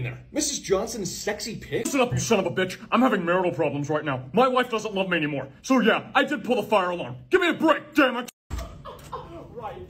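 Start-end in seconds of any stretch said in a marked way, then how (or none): 1.9-8.3 louder, building to a high yell; angry outburst. Acted. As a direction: snap back, rising to a shout at the end. Principal's angry confession.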